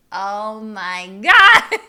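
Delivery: very loud, drawn-out exclamation; hammed-up, slow, booming disbelief; shocked realization. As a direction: cry out in mock horror, breaking into laughter.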